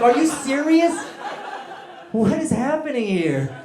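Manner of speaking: high, loud comic disbelief; incredulous yell. Retold or imitated. Guest reacting to karaoke at a wedding, incredulous yell. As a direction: yell it as if you can't believe it.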